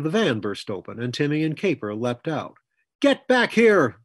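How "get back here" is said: slow, much higher and louder furious roar. A narrator's reading. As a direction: roar after the fleeing horses. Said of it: "Coy roared".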